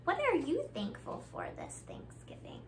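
ordinary delivery, nothing marked

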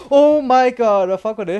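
loud, high burst of alarm; startled outcry. Real, not acted. Player jumps at a sudden figure in the maze, startled.

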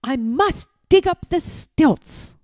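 high, loud and hurried; determined urgent cry. A narrator's reading. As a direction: push on with urgency. Urgent boy after gasping.

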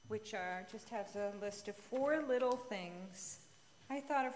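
ordinary talk, nothing marked

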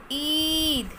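ईद with a long drawn ई; teacher showing the long ई; long vowel demo. Shown as a demonstration. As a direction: hold the ई in ईद long. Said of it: "इसमें लंबी sound आ रही है".

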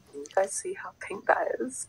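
hushed whisper. Real, not acted. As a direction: keep it under your breath. Showing product to camera.

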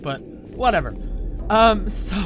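ordinary talk

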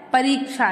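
"परीक्षा" with a long "ree"; correct pronunciation. Shown as a demonstration. Teacher giving the right word, correctly pronounced. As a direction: stretch the i in "परीक्षा": pa-reek-sha. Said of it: "क्या होता है?".

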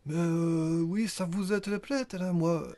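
high, halting mock Flemish accent. Nain flamand parle des croyants, faux accent flamand. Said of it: "C'est un nain flamand".

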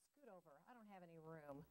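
very soft muttered complaint; complaining. A narrator's reading. Duck squeezed against Goose on the egg, complaining quietly. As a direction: grumble it far under your breath. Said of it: "complained Duck".

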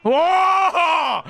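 loud, high, drawn-out whoa; long shouted cry of amazement; excited whoa. Real, not acted. Reacting to play in game.

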